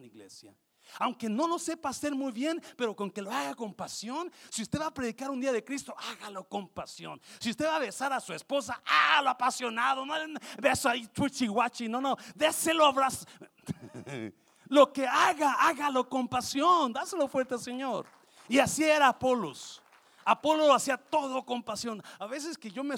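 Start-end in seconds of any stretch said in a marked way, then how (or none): 1.0-13.3 loud, high preaching at full force; fiery preaching. Acted. Pastor urging the congregation, loud fiery preaching. 14.7-22.0 loud, high preaching at full force; fiery preaching. Acted. Pastor urging the congregation, loud fiery preaching.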